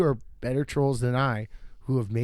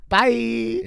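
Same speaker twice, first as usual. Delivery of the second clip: much higher, airy mock farewell; bright, higher mock goodbye to a rude driver; cheery bye; 9 semitones higher, 4 dB louder than usual. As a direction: wave off the guy who cut you off. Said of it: "you go".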